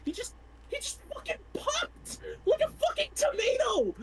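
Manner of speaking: shrieking in disbelief; horrified cry. Acted. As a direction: voice cracking high in horror. Horrified outburst in the log.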